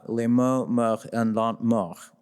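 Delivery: reading French with a Midwestern American accent; French line in a plain Midwestern American accent. Shown as a demonstration. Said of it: "I have a Midwestern accent ... in French".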